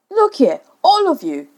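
high, loud jolly announcement; entertainingly. A narrator's reading. Father trying to amuse the children, loud cheerful call. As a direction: play the showman to win them back. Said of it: "he said entertainingly".

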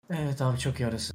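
yarı uykulu, kısık sesle cevap taklidi; uykulu mırıltı. Retold or imitated. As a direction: uykudan cevap veriyormuş gibi mırılda. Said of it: "Cevap veriyor bir şey sorduğun zaman". (uyku sersemi cevap taklidi)

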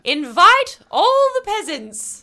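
high, slow haughty aristocrat; rich guy voice. Pretend. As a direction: lord it over the peasants. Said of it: "Do you wanna hear mine?".

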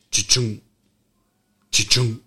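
slow mouth-sound of a car lock chirping twice; imitating car lock chirp. Telling friends about the truck, mimicking the lock chirp. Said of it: "it just sits there all night and goes".

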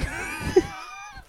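shouted laugh bursting out; loud laughing outburst; burst of laughter. Real, not acted. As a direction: laugh out loud at own joke. Laughing at punchline.

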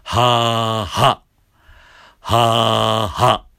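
loud ha with a firm glottal cut; voiced ha with stops. Shown as a demonstration. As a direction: voice the ha and clamp it shut. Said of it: "実際声を出して".

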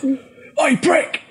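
high yelled insult from across a room; mock yell. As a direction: bark it out like a cheeky lad. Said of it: "Nick Frost just goes".

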